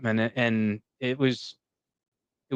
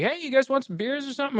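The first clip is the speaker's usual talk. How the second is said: quick, much higher, chummy bartender voice; imitating the bartender; 11 semitones higher, faster than usual. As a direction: rattle it off like an overfriendly barman.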